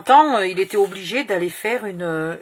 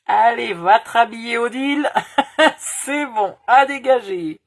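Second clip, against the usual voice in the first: put-on Romanian accent dismissal; 5 semitones higher, 9 dB louder than usual. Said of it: "elle parle avec un accent roumain".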